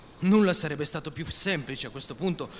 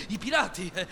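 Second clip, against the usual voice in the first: ordinary delivery, nothing marked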